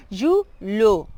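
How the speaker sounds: YULO loud, in two careful syllables, "ju-lho"; deliberate model of "yulo" as julho; Portuguese LH. Shown as a demonstration. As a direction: break "yulo" apart with a palatal l. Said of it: "aquella pronunciación con L H".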